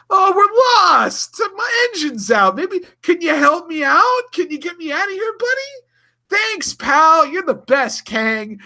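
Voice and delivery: very high, loud, fake-innocent pleading; mock pleading Sulu. Host imitating Sulu fooling Kang, very high fake-innocent voice. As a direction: squeak out a fake lost-traveler plea.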